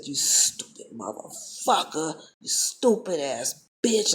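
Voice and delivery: slow, drawn-out retold insults; angry cussing. Teller relives silently cursing the boy, slow retold anger. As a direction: stretch each insult out with contempt. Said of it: "I was so angry".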